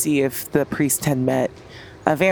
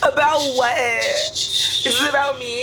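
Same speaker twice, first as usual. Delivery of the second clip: high, slow, teary pleading; tearful; 8 semitones higher, slower than usual. Acted. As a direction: whimper it, close to tears. Drunk, crying character.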